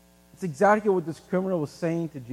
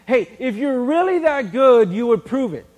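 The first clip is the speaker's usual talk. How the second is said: mock taunt; 4 semitones higher, 8 dB louder than usual. Taunting someone to prove it.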